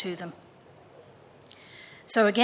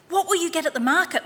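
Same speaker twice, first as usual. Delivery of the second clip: put-on eager little boy; 9 semitones higher, 5 dB louder, faster than usual. A narrator's reading. Boy voiced in story.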